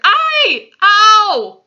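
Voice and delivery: high, loud yelps; roared cries of pain. A narrator's reading. Sleeping giant hurt in a nightmare, roared yelps. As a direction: yelp loudly, high above your talk. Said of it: "roared the flesh lump eater".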